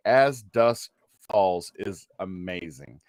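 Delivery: ordinary talk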